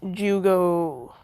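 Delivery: ordinary talk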